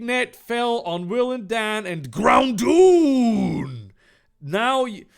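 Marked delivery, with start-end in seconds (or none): 2.1-3.9 long, loud, drawn-out shout; booming name call. Acted. Narrator names the netted creature, a loud shout. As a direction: belt out the name, stretching the last vowel.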